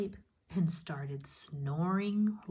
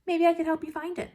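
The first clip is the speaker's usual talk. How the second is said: fast, much higher, louder playful offer; sly eager offer; 12 semitones higher, 8 dB louder, faster than usual. Acted. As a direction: fake helpfulness to trick her. Jack slyly tricks the woman.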